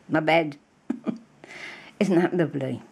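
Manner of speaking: usual speaking voice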